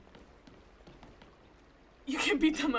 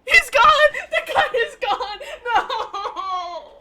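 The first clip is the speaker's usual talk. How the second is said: loud, higher alarmed yell with laughter; panicked shout; 8 semitones higher, 13 dB louder, much slower than usual. Real, not acted. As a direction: scream out that he's gone. Panics as NPC vanishes.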